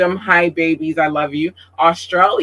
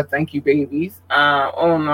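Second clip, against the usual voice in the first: ordinary delivery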